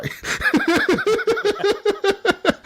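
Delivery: long high burst of real laughter. Real, not acted. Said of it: "I was fucking laughing".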